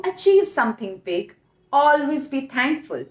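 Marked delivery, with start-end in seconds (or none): none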